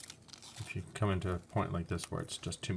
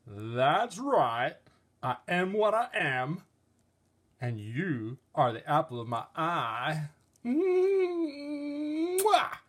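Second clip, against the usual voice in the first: higher, sing-song, ending in a kiss; loving figure; 9 semitones higher, 4 dB louder, much slower than usual. Acted. Object figure calling another the apple of its eye, lovingly. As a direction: blow a kiss to your sweetheart.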